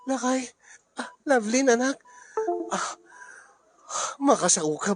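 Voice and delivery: normal talking voice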